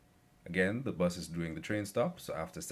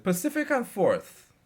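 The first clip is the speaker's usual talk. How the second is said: louder, slow and firm; raised voice; 13 semitones higher, 8 dB louder than usual. Retold or imitated. Narrator answering the lady on the bus, raised voice. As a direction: call the stop out over the crowd. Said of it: "I raised my voice and said".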